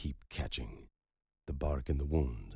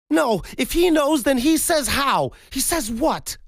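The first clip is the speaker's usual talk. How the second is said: very high, very loud, drawn-out wail; screaming grief; 15 dB louder than usual. Acted. The widow screams at Gus to say how, hysterical. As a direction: shriek the demand through grief.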